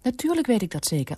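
high, firm retort. A narrator's reading. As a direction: insist firmly in a high voice. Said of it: "ditmaal ferm".

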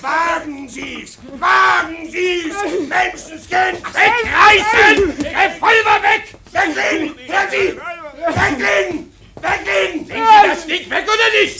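lauter, gedehnter Befehlston; brüllen, dass er die Waffe weglegt; laute Befehle. Acted. Handgemenge um einen Revolver. (er brüllt den Mann mit Revolver an, wegzulegen)

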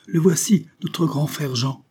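cri aigu d'une fillette, lent sur la fin; crier. A narrator's reading. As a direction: annonce l'arrivée du frère à tue-tête. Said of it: "la petite Marie qui criait derrière la haie". (la petite crie derrière la haie, voix haute)

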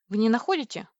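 usual speaking voice

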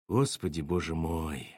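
low, soft, sinking voice; dismayed mutter. A narrator's reading. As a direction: murmur it to yourself, fading away. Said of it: "пробормотала Саша".

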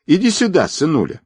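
high, insistent summons to a little son; coaxing. A narrator's reading. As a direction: coax the child over. Menacing father calls his son.

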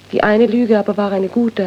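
ordinary talk, nothing marked

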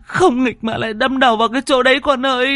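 high wailing mother's voice; wife crying out. A narrator's reading. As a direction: cry out like a grieving mother, fast. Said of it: "vừa bật khóc". (giọng người mẹ khóc than, cao vút)

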